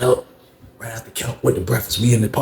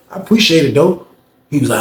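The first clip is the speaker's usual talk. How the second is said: usual speaking voice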